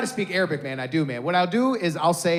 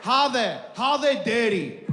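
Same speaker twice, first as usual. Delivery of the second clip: higher, faked Arabic-accented phrase; 6 semitones higher, slower than usual. Shown as a demonstration. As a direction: fake fluency with an Arabic accent. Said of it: "say it with an Arabic accent".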